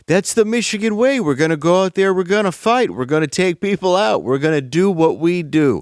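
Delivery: imitating a candidate's exaggerated Michigan accent; mock Michigan politician. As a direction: ham up a folksy Michigan rally cry. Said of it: "the way that she talks is like".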